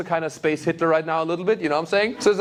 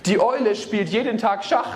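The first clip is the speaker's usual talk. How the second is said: quoting wife; 9 semitones higher than usual. Retold or imitated. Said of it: "she was like".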